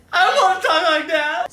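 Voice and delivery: high-pitched mock put-on voice; exaggerated high imitation. Mocking the affected New York talk.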